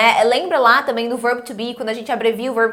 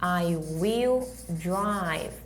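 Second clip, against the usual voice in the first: slow model English; 3 semitones lower, 5 dB quieter, much slower than usual. Shown as a demonstration. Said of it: "Eu falo".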